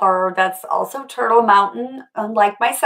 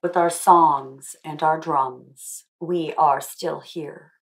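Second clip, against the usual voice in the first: slow, solemn picture-book reading; story reading; 2 semitones lower, 4 dB quieter than usual. Acted. Author reading her book's ending aloud to the schoolchildren, slow and solemn. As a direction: read the closing lines slowly, like a rallying call.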